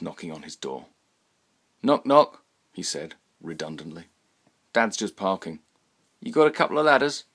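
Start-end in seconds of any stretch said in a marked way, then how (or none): 1.8-2.4 loud, high sing-song knock knock; cheeky knock knock. Acted. Teen at the door announcing himself, loud and cheeky. 4.7-7.2 quick, high, breezy questions; chirpy teen. Acted. Teenage son at the door, chirpy and quick.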